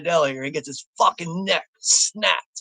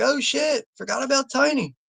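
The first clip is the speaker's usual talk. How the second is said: much higher-pitched sudden realization; mock surprise; 9 semitones higher than usual. Viewer remembering a forgotten character, surprised. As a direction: act out an oh-shit realization, pitched high.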